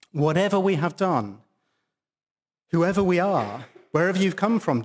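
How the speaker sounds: normal talking voice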